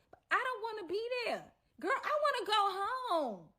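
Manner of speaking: high, pleading complaint; exasperated whine. Retold or imitated. Storyteller protesting being stuck at the house, exasperated whine. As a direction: whine it with the pitch way up.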